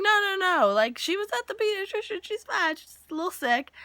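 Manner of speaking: high-pitched flustered cover-up; retold frantic brush-off; hasty mock excuse. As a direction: rush out a high, acted excuse.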